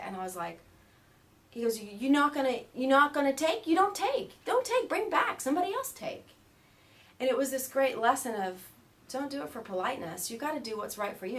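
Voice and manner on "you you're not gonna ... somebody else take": loud, rising high, emphatic orders; scolding yogi. Retold or imitated. Yogi telling student to return curd, high scolding. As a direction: climb higher with each insistent order.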